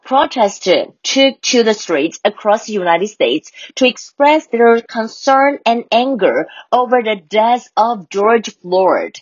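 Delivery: slow, careful reading of the example sentence; slow model reading. Shown as a demonstration. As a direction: read it out slowly like a teacher. Rereads the sentence slowly.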